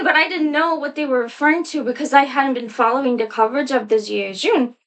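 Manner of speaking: usual speaking voice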